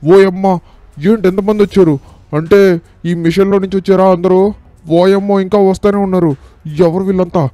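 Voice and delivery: high-pitched startled exclamations; alarmed surprise. Pretend. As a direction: cry out, startled at a sudden crowd. Startled by a crowd pouring in. (కంగారుగా, ఆశ్చర్యంతో)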